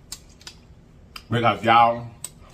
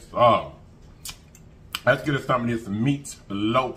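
normal talking voice

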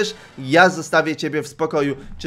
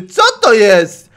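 startled shout; 4 semitones higher, 10 dB louder, slower than usual. Acted. Startled line on teleport.

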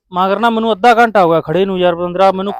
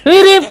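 squeaky mock whimper, much higher and louder; 12 semitones higher, 5 dB louder than usual. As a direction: squeal a teasing whine. Mocking the crying friend. (ਰੋਣ ਦੀ ਮਖੌਲੀਆ ਨਕਲ)

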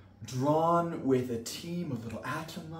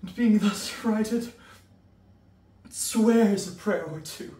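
loud, high bursts with long gaps; startled shouting; 9 semitones higher, 10 dB louder than usual. Acted. Mercutio acting the soldier jolted awake, high startled shouts.

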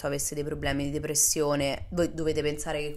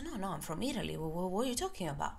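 soft, unsure protest; a touch of alarm at being called Russian; nervous denial; 3 semitones higher, 9 dB quieter than usual. Retold or imitated. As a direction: mumble your confusion politely. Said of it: "mi faccio".

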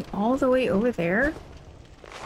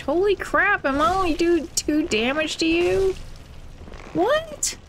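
incredulous; 7 semitones higher than usual. Real, not acted. Low damage dealt.

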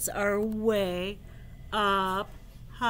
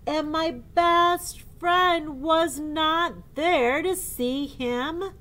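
building elephant wail; 7 semitones higher, 11 dB louder, slower than usual. Acted. Gerald voiced in book.

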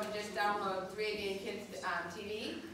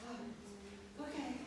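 meek whisper; 11 dB quieter, much slower than usual. Retold or imitated. Meek reply to director.

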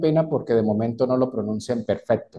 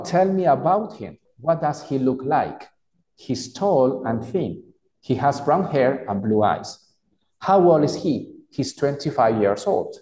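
slow, clearly pronounced English dialogue; slow model reading; slower than usual. Shown as a demonstration. Teacher rereads the dialogue for an exercise, slow and clear. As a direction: read it slowly so learners can follow. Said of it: "Voy a volverlo a repetir".

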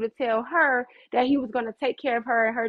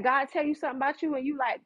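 ordinary talk

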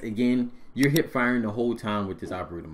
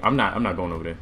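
ordinary talk, nothing marked

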